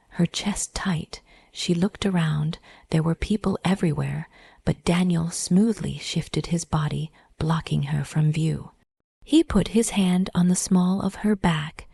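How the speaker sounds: usual speaking voice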